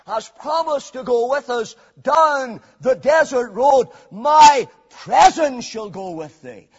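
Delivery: loud pulpit climax, slowing on the promise; forceful preaching. Real, not acted. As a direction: proclaim the promise with full weight.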